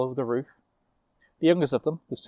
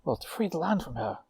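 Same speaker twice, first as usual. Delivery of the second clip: put-on eager young witch; 6 semitones higher than usual. [name] relays unicorn's tale.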